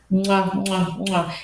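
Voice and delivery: blown kisses. Real, not acted. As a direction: blow kisses at the camera. Blowing kisses to viewers.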